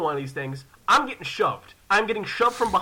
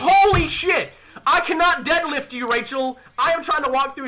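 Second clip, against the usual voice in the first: high, raised, strained protest; exasperated outburst; 7 semitones higher, 5 dB louder than usual. Retold or imitated. Brother protesting sister's weight on his back, exasperated. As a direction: complain loudly under a heavy load.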